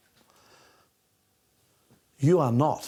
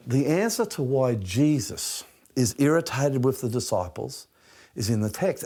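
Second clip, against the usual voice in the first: normal talking voice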